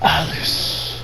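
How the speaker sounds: breathy whisper of Alice; sinister whisper; whispered name. Retold or imitated. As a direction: breathe the name like a creepy villain. Villain softly speaks her name.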